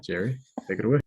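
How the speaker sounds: ordinary talk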